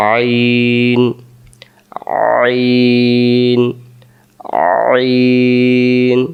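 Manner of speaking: আইন drawn out slowly, pressed from mid-throat; a long, strained আইন; throat-pressed ain. Shown as a demonstration. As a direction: squeeze আইন from the middle of the throat, long and slow. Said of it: "তাহলে আমরা বলবো".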